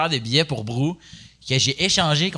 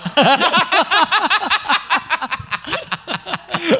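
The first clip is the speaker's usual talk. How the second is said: long, high laugh; long fou rire; 14 semitones higher than usual. Real, not acted. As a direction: burst out laughing at the joke. Rires autour d'une blague. (long éclat de rire aigu)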